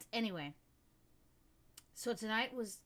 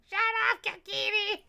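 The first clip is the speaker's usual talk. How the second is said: loud, high yell of shut up; mock yell at the cat; 10 semitones higher, 9 dB louder than usual. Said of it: "I was like".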